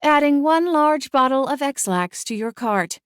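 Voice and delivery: lightly voiced smart speaker robot. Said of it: "a robotic female voice replied".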